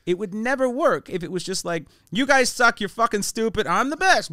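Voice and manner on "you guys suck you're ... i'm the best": loud, higher-pitched jeering rant; mock crowd-bashing. Comic imitates abusing his audience, loud mock boast. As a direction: hurl mock insults at the crowd.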